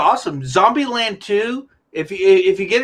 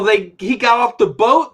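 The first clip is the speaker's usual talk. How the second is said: normal talking voice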